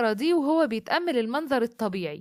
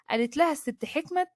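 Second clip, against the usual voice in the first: plain reading voice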